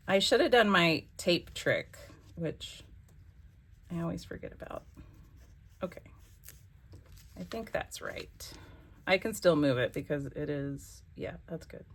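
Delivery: ordinary delivery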